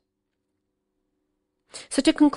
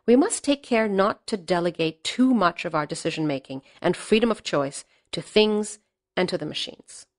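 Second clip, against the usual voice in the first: normal talking voice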